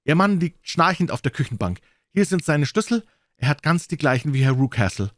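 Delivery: high, eager woman's voice; young governess, excited and keen. A narrator's reading. Miss Hunter's voice continues.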